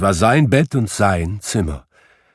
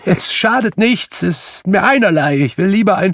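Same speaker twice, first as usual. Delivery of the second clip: much higher and louder, building to cheering; childlike joyful shouting; 12 semitones higher, 6 dB louder than usual. Acted. Scrooge whoops with childlike joy on Christmas morning. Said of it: "rief Scrooge".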